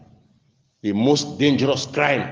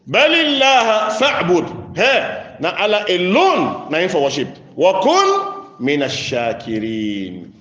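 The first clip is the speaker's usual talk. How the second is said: impassioned preaching; 6 semitones higher, 3 dB louder, slower than usual. Real, not acted. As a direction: ring out the verse, stretching the syllables.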